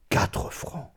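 hushed, breathless echo of the price; whispered. A narrator's reading. Narrator echoing the widow's outrage, whispered. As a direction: murmur it to yourself in shock.

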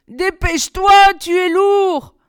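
strained, high, loud complaint; moaning. A narrator's reading. Touilly moans with the climber standing on their shoulders. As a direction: groan under the weight, slow and loud. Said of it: "gémit Touilly".

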